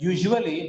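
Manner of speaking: "usually" spelled out with a full oo in the middle; mispronounced "usually". Shown as a demonstration. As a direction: say "usually" as YOO-zhoo-uh-lee. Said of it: "Sometimes we read it as".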